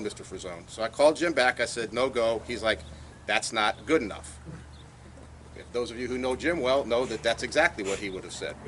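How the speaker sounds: ordinary talk, nothing marked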